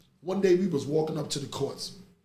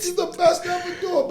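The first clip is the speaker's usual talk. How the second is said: laughing; 14 semitones higher, 5 dB louder, faster than usual. Real, not acted. Laughing repeat of the praise.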